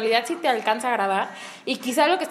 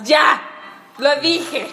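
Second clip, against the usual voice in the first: loud outburst; 5 dB louder, much slower than usual. Real, not acted. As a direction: blurt it out, loud and laughing. Blurts out a confession.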